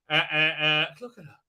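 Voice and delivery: ordinary talk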